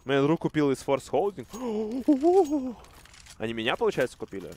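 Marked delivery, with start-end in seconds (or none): 1.5-2.7 sharp high gasp of shock. Real, not acted. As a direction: gasp in surprise. Shocked reaction to the play.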